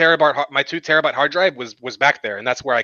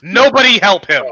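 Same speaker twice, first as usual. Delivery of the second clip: louder, higher emphatic command; emphatic order; 6 semitones higher, 8 dB louder than usual. Real, not acted. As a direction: call it out loud over the others. Repeats the order, louder, over helpers.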